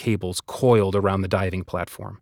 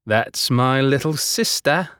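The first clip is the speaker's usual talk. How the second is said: proud, slow boast; bragging; 4 semitones higher, slower than usual. A narrator's reading. Vidius boasting about his sister Nisha, proud. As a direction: puff up with pride. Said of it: "Vidius bragged".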